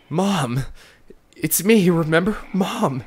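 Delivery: high, loud cries of Mom; desperate calling. Acted. Son shouting to his mother, desperate. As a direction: call out to make her remember.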